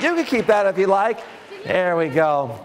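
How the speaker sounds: ordinary talk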